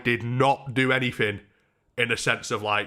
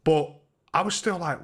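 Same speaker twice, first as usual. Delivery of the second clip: ordinary delivery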